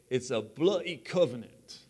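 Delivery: hammed-up English accent. Shown as a demonstration. Preacher joking with the congregation, mock English accent. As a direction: put on a mock-British accent. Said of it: "That's my best English accent right there".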